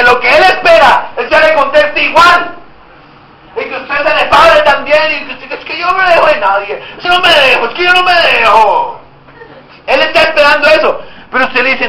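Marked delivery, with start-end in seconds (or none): 5.5-9.0 high-pitched, insistent, combative comeback; defiant quarrelling. Acted. Preacher acting someone answering in kind, defiant. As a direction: square up and refuse to back down.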